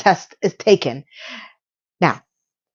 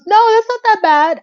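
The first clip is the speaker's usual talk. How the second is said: much higher, squeaky mock-reassuring voice; mock high reassurance; 13 semitones higher than usual. Mocking Steve reassuring Miranda, in a much higher squeaky voice. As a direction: pitch it way up as a sarcastic imitation.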